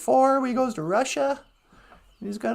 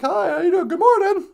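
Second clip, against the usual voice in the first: louder, higher chirpy morning hello from the deer; cheerful deer greeting; 3 semitones higher, 5 dB louder than usual. Retold or imitated. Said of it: "and they're like".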